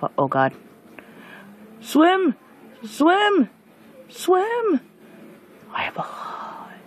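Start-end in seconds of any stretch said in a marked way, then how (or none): 1.8-4.8 high panicked shout; desperate yelling to swim; frantic yell. Real, not acted. Repeated frantic yells to swim. 5.7-6.7 hushed whisper; relieved whisper. Real, not acted. Whispered aside after danger.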